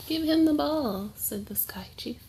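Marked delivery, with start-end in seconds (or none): 0.1-1.1 trickster tale voices. Acted. Sky Chief commands his daughter.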